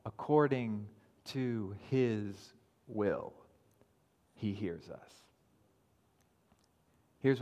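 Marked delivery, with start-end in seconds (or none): 0.0-5.2 "According to his will" drawn out slow and soft; slow emphasis. Shown as a demonstration.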